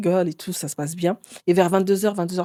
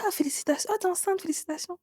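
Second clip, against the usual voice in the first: high, bright-eyed mock congratulations; imitating the friend's sugary high voice; 10 semitones higher, 9 dB quieter than usual. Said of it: "La copine, elle me dit". (la copine qui félicite d'une voix aiguë et haute)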